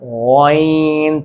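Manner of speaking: गॉइन stretched very slow and gentle; polite ghain. Shown as a demonstration. Teacher modelling a polite गॉइन for children, slow. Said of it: "थोड़ा सा polite way में लेके आना है".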